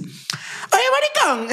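very high, comic taunting voice; hammed-up high-pitched call; exaggerated impression of Lu. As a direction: squeal it as a mocking caricature of a friend. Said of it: "mi percepción de cómo yo te percibo".

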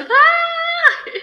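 high, loud burst of a cry, half laughing; sudden cry out. Retold or imitated. Recalling a meditation breakthrough, a sudden high laughing cry. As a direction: let out a sudden yelp with a laugh in it.

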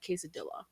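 Quesadilla with an English "dilla" ending; wrong pronunciation. Retold or imitated. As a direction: say Quesadilla as kay-suh-DILL-uh. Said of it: "as bad as someone being like".

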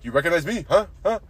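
mock-accent impression of an eager coworker; imitated Mexican coworker. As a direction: press for an answer with rising huhs. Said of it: "he gives me his I D".

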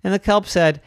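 usual speaking voice

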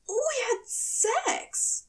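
high, very slow angel voice; stunned angel. Acted. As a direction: let each word land in disbelief. Aziraphale, slow disbelief.

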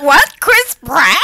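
loud, high-pitched disbelief; incredulous. Retold or imitated. Friend echoing reaction to Chris Pratt casting, incredulous. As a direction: blurt it out like shocking casting news.

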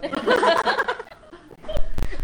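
loud open laughter; a real, high hearty laugh; big laugh. Real, not acted. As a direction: burst out laughing. Hearty laugh at shared joke.

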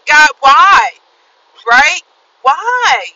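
loud, pleading why at God; upset cry at God. Retold or imitated. Retelling her tearful day, crying out at God loudly. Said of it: "I'm like".

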